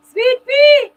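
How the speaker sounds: high, loud joyful call; excited greeting. Real, not acted. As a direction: shout her name with delight.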